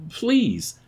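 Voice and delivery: high-pitched frightened begging; desperate plea. A narrator's reading. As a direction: cry out for mercy. Woman pleading while shaken.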